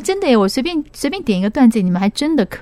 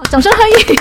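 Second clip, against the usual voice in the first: high, loud call for applause; excited host call; 12 semitones higher, 7 dB louder than usual. Real, not acted. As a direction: announce it with a big lift.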